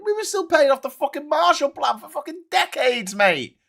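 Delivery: high, loud, exasperated protest; indignant rant. Real, not acted. Ranting about Marshall Plan debts, high indignant outburst. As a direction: protest at the top of the voice.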